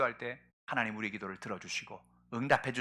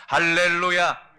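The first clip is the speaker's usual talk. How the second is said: hallelujah shout; 6 semitones higher, 13 dB louder, much slower than usual. Acted. Preaching exclamation.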